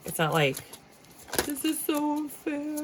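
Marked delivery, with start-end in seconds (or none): 1.4-2.8 high, slow whiny complaint; hammed-up sulky whine; mock whine. Tarot reader voicing whiny querent.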